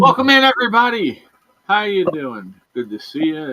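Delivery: high, loud cheerful welcome; show host greeting. Acted.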